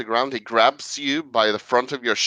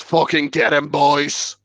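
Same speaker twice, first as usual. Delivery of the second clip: gang boss yell; 4 semitones higher, 4 dB louder than usual. Acted. Thug boss orders his gang.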